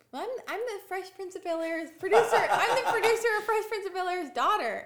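put-on high, loud, giggly child voice; little girl voice. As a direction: play the proud little kid, laughing through it. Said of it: "and I just go".

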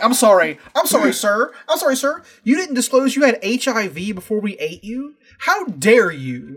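high, loud sarcastic outrage; mock indignation. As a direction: ham up the cannibals' offended indignation. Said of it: "the indignation of".

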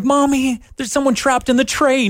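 put-on high frightened kid calling Mommy; scared child voice. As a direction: play a spooked little kid. Child scared of train face.

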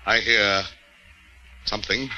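soft, low and fearful; hushed dread. Acted. Bound prisoner hearing a sound above him, hushed dread. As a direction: barely voice it, listening hard.

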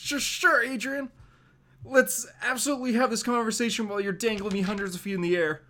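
deadpan jab while dangling; sarcastic quip. Acted. Peter mocking the Vulture while dangling high up.

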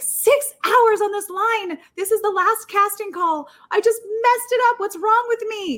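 high, drawn-out mock wail; retold near-tears. Contestant berating herself after freezing, retold near-tears despair. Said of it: "tears are welling up in my eyes".

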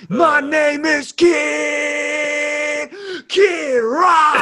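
very high, slow shouted rock-star intro; [name] shout. Acted. Impersonating [name] as baby.

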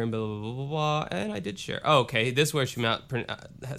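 ordinary delivery

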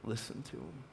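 a touch of the divine voice; voice of God. Acted. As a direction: intone it quietly from above. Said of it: "And then God says".

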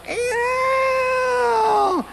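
a very high, strained little cry; a high squeaky creature yelp; funny little voice. Retold or imitated. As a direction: squeal it out like a tiny creature leaving. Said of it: "A little old funny voice began to come out of him".

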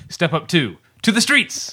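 high, playful title call; high mock title. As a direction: sing out "To the Streets" high. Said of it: "refers to it as".

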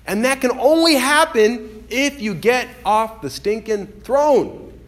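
high, building pulpit delivery; impassioned preaching. Real, not acted. Preacher urging congregation toward transformation, impassioned preaching. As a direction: lift the voice and drive to the last word.

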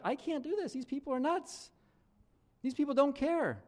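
exasperated Paul. Retold or imitated. Paul complains to God.

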